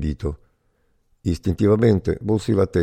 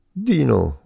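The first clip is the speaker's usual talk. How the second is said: mild, high-pitched cry of a friend's name; joyful call; 7 semitones higher than usual. A narrator's reading. Prisoner finding his friend in the cell, mild joyful yell. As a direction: call out gladly, pitch jumping up, without full shouting.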